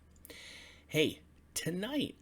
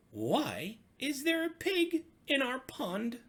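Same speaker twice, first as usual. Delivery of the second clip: high, squeaky frog voice, startled; surprised frogs; 10 semitones higher, 6 dB louder than usual. Acted. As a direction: chirp it out like an amazed little frog. Frogs exclaim at pig in pond.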